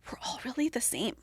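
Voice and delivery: quick confiding whisper. Real, not acted. She confides to the listener in a whisper. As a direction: lean in and breathe it like a secret.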